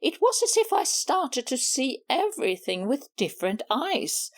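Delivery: usual speaking voice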